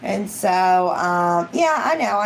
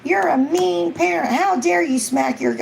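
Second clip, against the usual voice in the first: much higher mock outraged busybody; shrill-free high mock scolding onlookers; mocking critics; 9 semitones higher than usual. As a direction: parody the online scolds. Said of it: "people are like".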